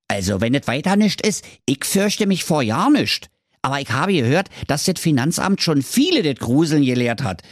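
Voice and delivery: put-on brash and quick like a street kid; lightly voiced cheeky youth; cheeky lad Thorsten. Thorsten answers the king.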